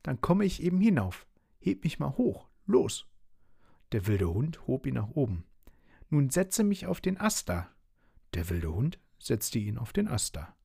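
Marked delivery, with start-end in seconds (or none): 0.0-3.1 very high, small character voice; bossy little Schnuddel. A narrator's reading. Schnuddel tells the dog to lift him, high and bossy. 6.1-7.7 high small voice giving an order; bossy little Schnuddel. A narrator's reading. Schnuddel wants onto the branch, high-pitched command.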